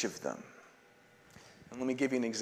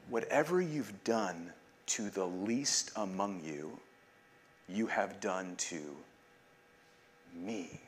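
softer, slower, dropping almost to a murmur; hushed reverent quote; 5 semitones lower, 9 dB quieter, slower than usual. Retold or imitated. Preacher quoting Jesus to the congregation, hushed and slow. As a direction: let the words of Jesus settle quietly.

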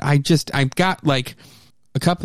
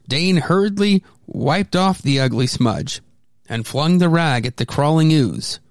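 plain reading voice

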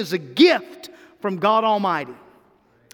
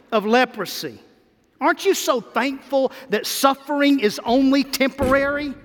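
ordinary talk

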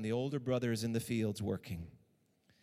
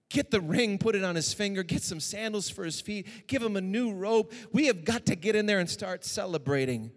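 louder, higher call of a glad father; joyful father; 6 semitones higher, 4 dB louder than usual. Acted. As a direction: shout out the orders for the feast. Father welcomes son home joyfully.

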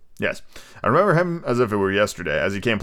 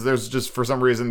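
ordinary delivery, nothing marked

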